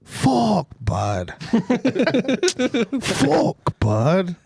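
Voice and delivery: high, exaggerated Canadian cursing; mock Canadian accent. Doing a Canadian on request, exaggerated accent. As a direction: curse like a cartoon Canadian, pitch leaping. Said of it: "now do a Canadian".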